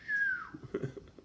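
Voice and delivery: a sudden high-pitched giggle; a short giggle shooting way up; high squealing laugh. Real, not acted. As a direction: squeal with laughter at your own tease. Laughing over a teaser.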